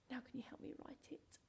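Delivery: soft unvoiced whisper; a quick whispered request. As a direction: whisper it like a secret to the class.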